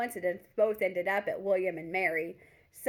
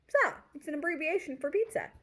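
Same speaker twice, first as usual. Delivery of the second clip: quoting Lily; 8 semitones higher than usual. Retold or imitated. Lily voiced in retold chat.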